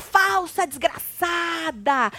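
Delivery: high, shouted insults, drawn out at the end; mock furious tirade. Fu calling Kali a liar, mock furious tirade. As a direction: fling each insult in a hammed-up rage.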